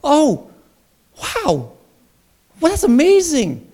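high, loud, hammed-up surprise; mock amazement. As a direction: gush like it finally clicked. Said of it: "it's like".

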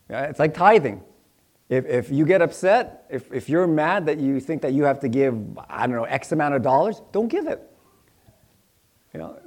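ordinary delivery

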